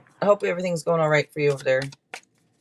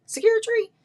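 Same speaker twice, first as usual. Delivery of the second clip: high squeal of the word, much higher; excited exclamation; 3 dB louder than usual. Real, not acted. Tarot reader thrilled by the card, high squeal. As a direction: sing out the card's name with delight.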